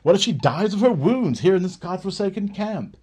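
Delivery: loud, high exasperated demand; frustrated outburst. Acted. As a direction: push it out in frustration.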